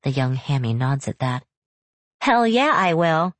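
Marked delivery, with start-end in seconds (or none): 2.2-3.4 eager high shout of agreement; fired-up kid answering back; excited. Acted. Child fired up by mother.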